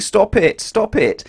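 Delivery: high, laughing squeal of a teacher being tickled; laughing teacher's plea. A narrator's reading. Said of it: "the teacher was laughing out loud and saying".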